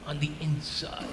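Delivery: low, very soft aside; hushed aside. Real, not acted. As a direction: drop to a confidential near-murmur. Silent agreement, then repeated aloud.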